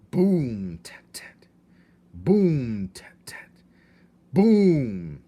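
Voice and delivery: loud breathy boom, tiny tat tat; a mouse beating a thimble drum; drum imitation. A narrator's reading. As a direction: voice the drumbeat. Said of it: "The rhythm of the drum".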